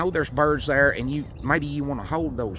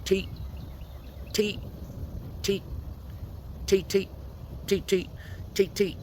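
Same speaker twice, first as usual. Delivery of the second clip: short squeaky teet, mostly higher; rodent chirp teet; 7 semitones higher, 5 dB quieter than usual. Shown as a demonstration. Hunter demonstrating rodent chirp, squeaky higher teet. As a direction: chirp teet like a mouse in distress. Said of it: "and I'm just gonna go".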